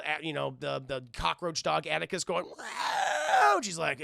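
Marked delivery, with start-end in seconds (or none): 2.4-3.6 high, loud drawn-out howl; imitated dog howl. Storyteller imitates the cockroach dog Atticus, high howl.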